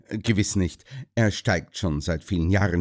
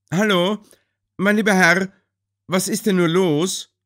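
lauter Zuruf; 9 semitones higher, 4 dB louder than usual. A narrator's reading. Holmes ruft Sir Henry an.

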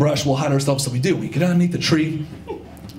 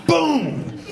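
high, booming explosion noise; imitated bang; 8 semitones higher than usual. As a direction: shout "boom" like balloons bursting. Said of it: "It sounded like maybe, like, ten balloons popping".

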